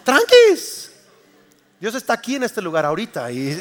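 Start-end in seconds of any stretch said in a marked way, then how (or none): 0.0-0.9 squeaky high, loud playful chill out; jokey high reassurance to restless listeners; high-pitched mock soothing. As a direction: toss it off in a silly high voice. Preacher mock-soothes impatient audience.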